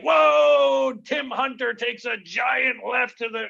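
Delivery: high, loud play-by-play yell; hockey announcer shout. Retold or imitated. Comic calls a bar brawl like a hockey game, shouting. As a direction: call the fight like a hockey announcer. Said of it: "I did the hockey announcements for the brawl".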